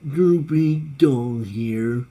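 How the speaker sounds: very slow, drawn-out cartoon dog; Droopy Dog. Cartoon dog greeting all you people, slow droopy voice. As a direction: drag each word out like a droopy cartoon hound. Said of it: "really good impression of that dog".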